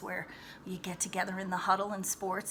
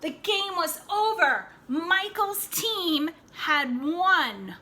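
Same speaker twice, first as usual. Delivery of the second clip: excited announcing; 7 semitones higher, 8 dB louder, slower than usual. A narrator's reading. Victory announced in the story.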